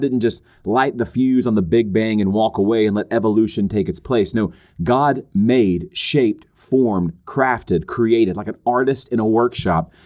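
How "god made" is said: slow, weighty "made"; stress on MADE. Real, not acted. Preacher correcting the idea of a hands-off God, stress on "made". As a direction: draw out "made" for emphasis. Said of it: "emphasize the word made".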